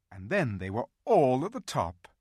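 usual speaking voice

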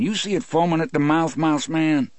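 "mouse man" thrown up high and fast in a jeering question; mocking question. A narrator's reading. Mocking question to nervous guard.

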